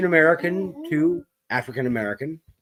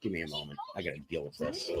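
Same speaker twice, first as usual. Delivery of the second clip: much lower and softer, away from the mic; 11 semitones lower, 16 dB quieter, much faster than usual. Real, not acted. Host steps away from the mic to deal with a disruption. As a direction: say it turned away from the microphone.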